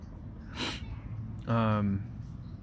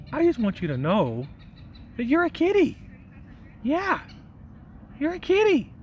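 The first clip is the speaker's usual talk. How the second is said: much higher, squeaky kitty talk; high baby talk to a cat; 13 semitones higher than usual. Retold or imitated. Talking to a cat, high squeaky baby talk. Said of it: "I go".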